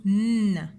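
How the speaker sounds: "n" as a loud held hum; isolated N sound. Shown as a demonstration. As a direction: sound out a long bare "nnn". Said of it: "qui fait le son".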